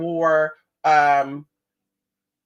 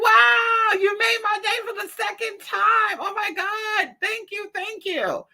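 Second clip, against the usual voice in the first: high, gushing stranger thanking them; mock squeal of an overjoyed stranger; a grateful stranger gushes at the sisters, high mock squeal; imitating gushing man; slower than usual. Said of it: "And he's like".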